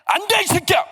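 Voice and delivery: high, strained furious outburst; angry father-in-law. Retold or imitated. Father-in-law refusing the suitor, angry shout. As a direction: refuse with one explosive high yell. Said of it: "화가 너무 나요".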